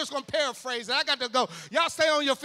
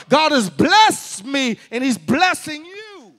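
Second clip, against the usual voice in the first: rising preacher's declarations, getting louder; preached declaration; 11 dB louder than usual. Acted. Preacher declaring blessing over the congregation, building. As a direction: speak it over your life like a preacher.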